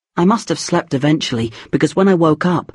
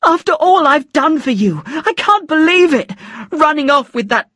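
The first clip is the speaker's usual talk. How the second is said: furious screaming; 11 semitones higher than usual. A narrator's reading. Mother raging.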